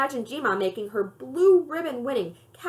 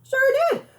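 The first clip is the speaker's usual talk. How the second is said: proud grandma; 4 dB louder than usual. Acted.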